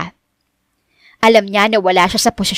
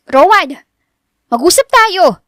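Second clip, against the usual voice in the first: high, urgent shout to stop; demanding, pitched-up call; calling after someone; 9 semitones higher, 4 dB louder, slower than usual. A narrator's reading. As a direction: raise pitch and call out insistently. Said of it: "Hingal na hingal si Richelle".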